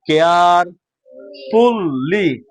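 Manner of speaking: very slow, each syllable on its own; drawn-out beginner's reading; slowly, bit by bit. Shown as a demonstration. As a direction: dictate word by word with long gaps. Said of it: "আস্তে আস্তে".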